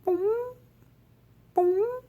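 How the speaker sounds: tiny, very high-pitched boom; high "boom" noise. A narrator's reading. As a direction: squeak out each boom like a bounce. Bouncing sound effect in story.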